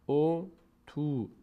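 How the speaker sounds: drawn-out "او تو" with long u; wrong long u. Shown as a demonstration. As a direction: slowly stretch "او تو" as u-tu. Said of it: "ضمه بعدش واو".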